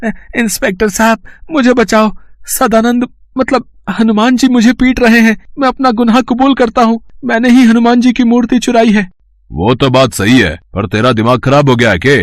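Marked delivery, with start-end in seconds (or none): 0.0-9.1 high, breathless panicked pleading; terrified confession. Acted. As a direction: blurt it all out to be saved. Thief runs to police, terrified.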